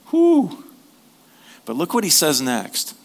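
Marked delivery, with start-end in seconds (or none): 0.1-0.6 high mock whew. Real, not acted. Reacting to "be humble.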